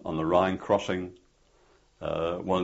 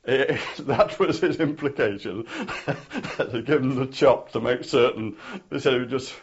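chuckling through the answer, pitched up; laughing; 6 semitones higher than usual. Real, not acted. Amused answer to interviewer's question.

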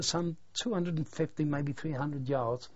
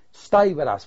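firm loud order; 14 dB louder than usual. Retold or imitated. Urging correspondent amid fire.

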